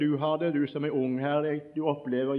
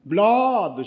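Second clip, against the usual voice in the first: loud, high jeering shout of "Bladet"; "Bladet" shouted out high and loud, falling at the end; crowd shout; 6 semitones higher, 9 dB louder than usual. Retold or imitated. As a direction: yell "Bladet" like a whole bus jeering. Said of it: "Så roper de ut alle sammen".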